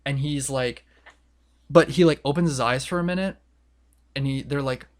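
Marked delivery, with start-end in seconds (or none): none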